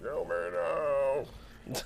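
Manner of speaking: very slow, faint, drawn-out moan; kill me now. Retold or imitated. Sleepless worker at the job, very slow faint moan. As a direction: let it die out slowly, drained. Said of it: "I'm like".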